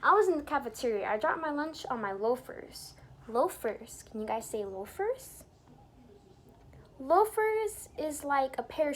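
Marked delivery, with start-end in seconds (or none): none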